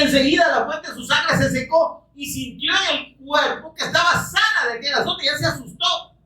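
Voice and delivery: high, fervent sermon voice; full-force preaching. Acted. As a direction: preach it out, lifted high and urgent.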